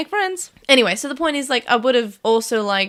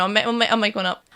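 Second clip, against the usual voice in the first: usual speaking voice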